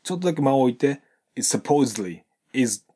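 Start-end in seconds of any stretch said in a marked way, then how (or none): 1.3-2.2 "is supposedly" linked, the S running straight into "supposedly". Shown as a demonstration. Teacher showing students the linking, smooth and joined. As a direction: blend "is" into "supposedly" with no break. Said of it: "こんな感じでくっつけてみましょう".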